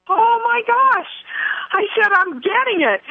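high, delighted "oh my gosh" squeal; excited squeal. Real, not acted. To her viewers, realizing she finally gets it, high thrilled squeal.

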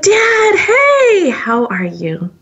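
high, drawn-out happy hello; delighted greeting. Retold or imitated. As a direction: re-enact a thrilled greeting to Dad. Greeting long-lost father on phone.

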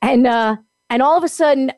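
ordinary talk, nothing marked

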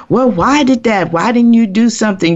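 high, hurried questioning; imitated blaming questions. Mimics people's blaming questions.

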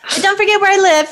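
put-on high, quick, giggling joke to the kid; laughing send-off. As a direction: laugh through the reminder. Playful parent send-off joke.

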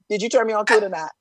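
ordinary delivery

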